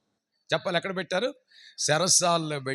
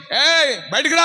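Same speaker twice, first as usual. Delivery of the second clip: a loud high call; 10 semitones higher, 7 dB louder than usual. Acted. God calling Abraham outside, loud call. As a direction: call him out.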